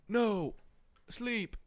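spaced-out meth head. Pretend. Host playing a jittery meth user, high and slow. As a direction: drag the words out in a daze.

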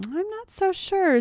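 high, singsong feigned hesitation; teasing doubt. A narrator's reading. Molly toys with begging Tracy, high teasing doubt. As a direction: dangle the refusal in a high lilt.

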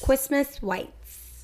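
put-on slow, high baby voice, w for l; mocking baby talk. Shown as a demonstration. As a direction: stretch it out like a toddler. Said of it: "How do you say lights in a baby voice".

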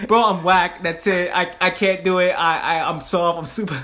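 friend's defeat parodied as a loud wail; hammed-up whine.

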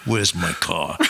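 low, menacing retold demand; contained fury. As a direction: drop the pitch and seethe, reenacting it. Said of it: "I have fire coming out of my fucking ears".

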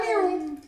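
very high tiny cub meow; lion cub meow. Retold or imitated. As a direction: mew like a baby lion. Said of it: "the baby roar going on".

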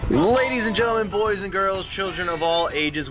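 ringmaster-style show intro; grand entrance announcement. Acted. Podcast opening announcement.

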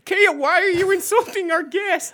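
hammed-up outrage; mock indignation. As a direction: take the co-host to task. Scolding co-host in mock quarrel.

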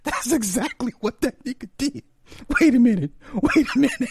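squeaky high voice through laughter; barely holding it together; high giggly talk; laughing. Real, not acted.

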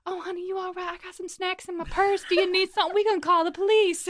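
high, fussing drag queen voice. Retold or imitated. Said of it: "the drag queens were like".

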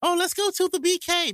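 high, breezy voice mocking a naive tourist. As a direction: toss it off high like someone who's never been to Brooklyn. Mocking [name] saying BK.

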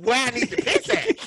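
high, squeaky disbelief; high-pitched plea to find the toilet; exasperated. Retold or imitated. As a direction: pitch it way up in frustration. Exasperated question to woman.

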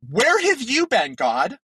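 high, indignant rant at an absent God; exasperated Gideon. Pretend. Gideon complaining to God about oppression, high and exasperated. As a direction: whine it up high, fed up and accusing. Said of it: "I'd be like".

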